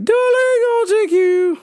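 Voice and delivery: high mock-mother lilt; imitated mom. Mom offering the daughter a lift, high imitation. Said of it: "my mom's like".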